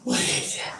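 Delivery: whispering. Real, not acted. Admiring the dog quietly.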